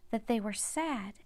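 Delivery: plain reading voice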